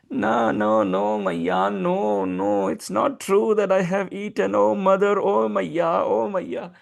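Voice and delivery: put-on high, insistent little boy's denial; pleading child. Child Krishna to mother Yashoda, high pleading denial.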